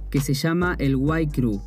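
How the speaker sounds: ordinary talk, nothing marked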